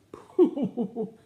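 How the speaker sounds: high, loud hooting bellow; walrus bellow. Shown as a demonstration. Reader prompting children for a walrus sound, bellowing. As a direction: hoot out a long walrus call. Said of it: "Do a walrus sound for me".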